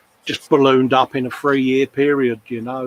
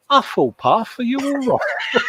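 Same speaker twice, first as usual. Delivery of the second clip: mock concern; 11 semitones higher than usual. Baby-talk check on the pet.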